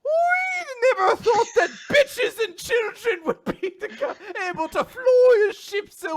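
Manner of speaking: high, loud, slow Irish shout breaking into laughter; hammy Irish captain laughing through it. Pretend. Captain praising the crew in hammy Irish, laughing. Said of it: "a stereotypical Irish guy".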